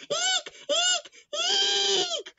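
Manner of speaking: high squealing eeks; screams. Acted. Girl frightened by chicken feet, high squeals. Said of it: "the girl screamed".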